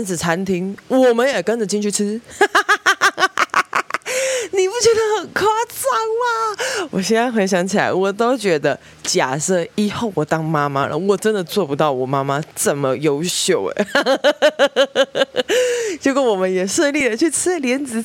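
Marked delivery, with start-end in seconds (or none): 2.2-4.4 high-pitched burst of laughter. Real, not acted. Laughs at mom's tailing plan. 4.5-6.9 very high, squeaky, laughing through the words; laughing question. Real, not acted. Laughing at the absurdity. 13.9-15.9 laugh. Real, not acted. Laughs after praising mom.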